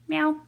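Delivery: short, high cat meow; playful meow. Pretend. Streamer capping own jingle, playful meow. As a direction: toss in a high little meow.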